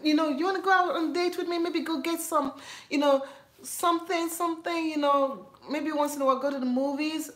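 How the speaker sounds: high, fast, fumbling invitation; imitating fumbling suitor. College guy fumbling an invitation, high and fast. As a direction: stumble through asking her out. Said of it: "So they said that".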